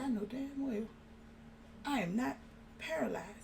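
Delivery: soft, stunned self-talk; hushed disbelief. Retold or imitated. Woman waking unable to move tells herself she's not, hushed disbelief. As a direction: quietly deny it to yourself, pausing between phrases.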